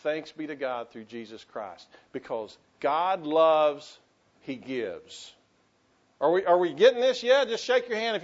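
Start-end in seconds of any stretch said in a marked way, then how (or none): 2.1-4.0 each word set apart, slow; a slow, deliberate teaching line; slow, weighty statement. Shown as a demonstration. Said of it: "say it with me". 4.4-5.3 quiet, lower resolution; a hushed payoff; soft, low close. Shown as a demonstration. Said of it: "say it with me".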